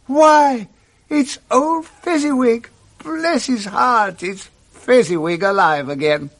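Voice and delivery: high, delighted cry; great excitement. Acted. Scrooge spotting his old master, high excited cry. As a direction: burst out in joyful recognition. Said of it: "Scrooge cried in great excitement".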